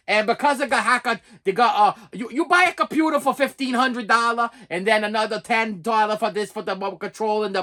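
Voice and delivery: mock foreign call-agent accent; halting imitation of foreign-accented English; imitated foreign accent. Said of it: "imitation of his accent".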